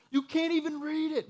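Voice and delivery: high-pitched exasperated complaint. Retold or imitated. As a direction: squeak out the frustration. Quotes his own frustrated thought.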